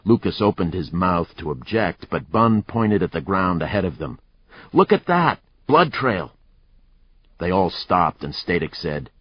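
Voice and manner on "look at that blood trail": excited discovery. Acted. Spotting blood trail, excited.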